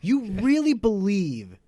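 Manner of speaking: high, loud and drawn out; hammed-up astonishment; incredulous. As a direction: spell out your disbelief slowly. Acted disbelief at gullible brother.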